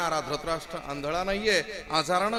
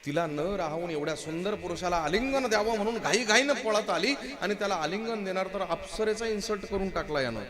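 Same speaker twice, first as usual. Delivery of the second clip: ordinary talk, nothing marked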